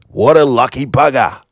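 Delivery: cheeky put-on Australian accent. Host capping a lucky winner's story, in an Australian accent. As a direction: round off the yarn in mock Australian. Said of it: "I've gotta do an Australian accent".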